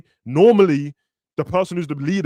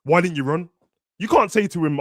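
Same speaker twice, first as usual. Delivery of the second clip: ordinary talk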